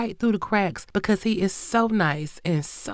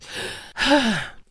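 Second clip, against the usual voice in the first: long, heavy exhale; weary sigh; 5 semitones higher than usual. Real, not acted. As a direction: let out an exasperated sigh. Weary reaction to the case.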